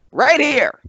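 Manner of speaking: big high ringside call; hyped announcer. Acted. Historian setting the scene for Dan, hyped call. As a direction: sell it like a live arena. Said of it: "announcer-style build".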